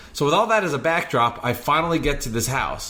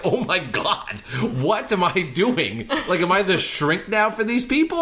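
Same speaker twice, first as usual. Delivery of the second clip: laughing disbelief; 7 semitones higher than usual. Retold or imitated. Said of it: "I was like".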